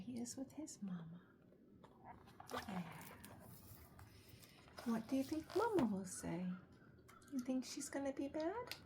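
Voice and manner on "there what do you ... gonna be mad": usual speaking voice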